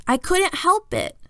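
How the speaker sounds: high, slowed whiny protest; whining. Acted. Little brother makes excuses to Mom in a whine. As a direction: drag it out like a kid making excuses. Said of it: "kind of whining".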